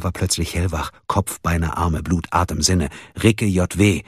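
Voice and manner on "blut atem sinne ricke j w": usual speaking voice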